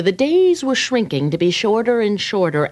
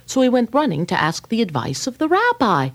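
ordinary delivery

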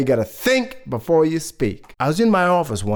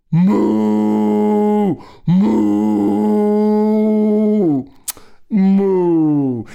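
long, held moos; cow imitation; 5 semitones higher, 4 dB louder, much slower than usual. Third brother up a tree fools his sister, cow imitation. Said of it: "Her brother up in that tree goes".

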